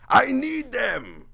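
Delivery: high, slow drawn-out plea; a hammed-up desperate cry; mock desperate shout. Real, not acted. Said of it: "pleading with Jimmy".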